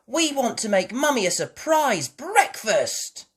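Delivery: put-on high, loud and eager little girl; eager piglet asking for help; excited. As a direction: bubble over with excitement. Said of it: "said Peppa excitedly".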